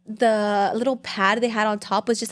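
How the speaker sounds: ordinary talk, nothing marked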